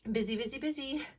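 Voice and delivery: sing-song mocking repeat; mocking singsong. Mocking the excuses.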